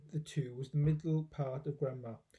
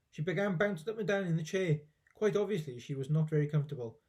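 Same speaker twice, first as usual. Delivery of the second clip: ordinary delivery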